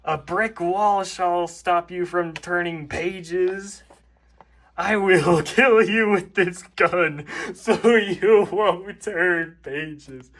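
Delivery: hammed-up villain threat with a laugh; menacing Toad. Toad threatening the reader with a gun. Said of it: "Toad voice".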